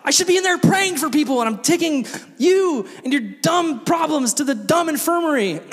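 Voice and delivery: high, hammed-up fed-up tirade; exasperated rant. Counselor silently resenting the infirmary walk, mock exasperated rant. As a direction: open on a big groan, then rant indignantly. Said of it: "in my mind, I was like".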